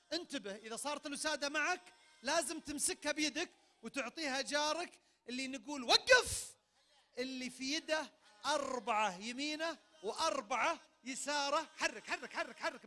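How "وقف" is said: loud sharp call to halt; shouted stop. Real, not acted. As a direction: yell stop to freeze the game. Host shouts stop in game.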